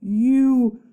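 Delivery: loud, hurt disbelief; stunned. Acted. Stunned boy questioning his friend, loud and hurt. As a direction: ask it in shock. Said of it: "Charlie was stunned".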